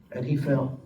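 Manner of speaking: preacher lowering his voice for the sacrifice; hushed solemn sermon close. Acted. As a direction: let it sink in quietly.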